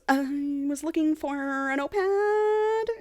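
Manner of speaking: high, slow, drawn-out fib; stalling lame excuse. Retold or imitated. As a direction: stretch it out like a caught snooper improvising. Voicing a caught snooper's feeble excuse.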